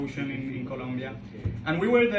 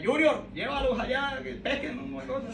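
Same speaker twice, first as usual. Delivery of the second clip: imitated islander calling out, higher pitched; calling his son; 8 semitones higher than usual. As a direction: call out to your boy in Spanish. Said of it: "So he just calls his son".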